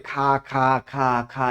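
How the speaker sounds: slow, soft drawn-out খা খা; mock empty-field sound. Shown as a demonstration. Teacher acts out the empty field sound. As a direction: breathe out খা খা slowly and softly. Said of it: "মাঠ কি এরকম করে".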